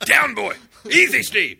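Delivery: loud, high playful command; mock dog command. Podcaster reining in a worked-up cohost, playful mock dog command. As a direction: call him off like an excited dog.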